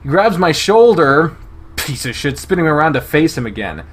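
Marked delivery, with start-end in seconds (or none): none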